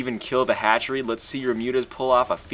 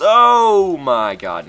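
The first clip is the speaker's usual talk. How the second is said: slow, loud, higher oh my god; drawn-out amazement; 8 semitones higher, 12 dB louder, much slower than usual. Real, not acted. Gamer stunned by his own fight, slow loud awe. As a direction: stretch it out in awe. Hype after winning fight.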